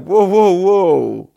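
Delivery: high, loud mock alarm; hammed-up startled yelling; alarmed whoa. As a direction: shout it out as if the cops just pulled you over. Police stop, retold alarm.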